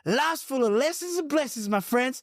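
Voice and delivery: high, preachy send-off in mimicry; imitating excitable stranger. Host imitating a stranger's parting wisdom, high and hyped. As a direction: mimic a hyped-up street philosopher.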